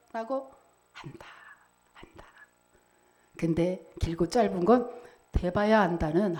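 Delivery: usual speaking voice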